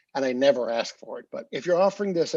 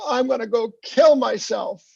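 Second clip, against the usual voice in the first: put-on higher, louder melodramatic child; a kid not meaning it; a hint of a melodramatic teen; dramatic kid; 7 semitones higher, 5 dB louder than usual. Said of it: "when kids say to their parents".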